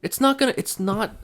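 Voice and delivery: very high, loud indignant protest. Retold or imitated. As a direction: insist like you were right all along.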